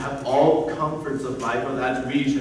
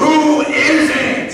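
high, loud, drawn-out demand; exasperated shout; 11 semitones higher, 5 dB louder, slower than usual. Acted. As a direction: explode with impatience, stretching the words. Father explodes after suitor stammers.

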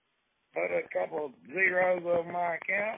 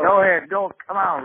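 impatient loud urging; 2 semitones higher, 7 dB louder than usual. Acted. Caller impatiently urges the agent.

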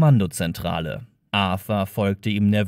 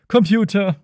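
loud call to the ship's computer; 7 semitones higher, 5 dB louder than usual. Acted. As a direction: shout it out to the machine. Calling the computer.

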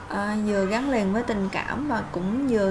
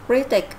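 louder "Critic" with CRI stressed, falling; stress first syllable; 5 dB louder than usual. Shown as a demonstration. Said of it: "nhấn âm thứ nhất".

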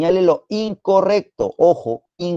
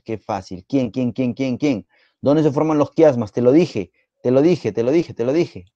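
usual speaking voice

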